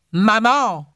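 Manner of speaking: put-on very high child's call; a small child's cry for mother; childish voice. A narrator's reading. Spirit child calls a third time, childish cry.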